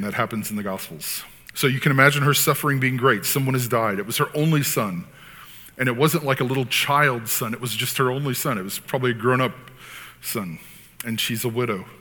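ordinary talk

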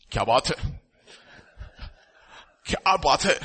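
put-on Hindi-accented admiring exclamation; admiring onlookers in north Indian accent. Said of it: "Everybody is seeing me".

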